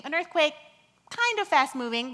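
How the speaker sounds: normal talking voice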